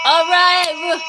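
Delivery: rally everyone at the end; hyped call. Real, not acted. Hype close of the space.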